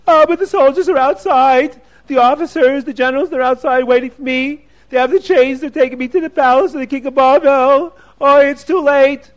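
a trapped man pleading it's too late; anxious rich man. Acted. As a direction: worry it out high, soldiers waiting. Rich man fears the soldiers.

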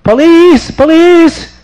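high, loud panicked cries; screaming for help. Acted. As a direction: shout for the police. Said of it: "is screaming for help".

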